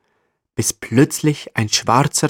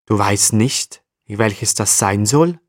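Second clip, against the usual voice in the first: usual speaking voice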